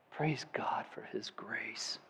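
slow, breathless whispered praise. Real, not acted. Preacher closing in reverent praise, whispered. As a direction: breathe it out in awe. Hushed praise ending the sermon.

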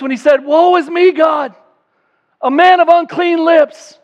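loud, high, impassioned preaching; full-force preaching. Acted. Preacher urging the congregation about a holy God, full-force preaching. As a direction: thunder it out from the pulpit.

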